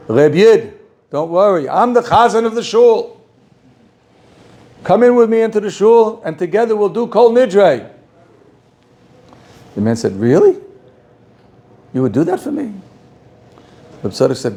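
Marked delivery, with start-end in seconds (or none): 0.1-7.9 reassuring. Retold or imitated. Rabbi comforts the crying man. 10.2-12.7 astonished. Retold or imitated. Man amazed at the offer.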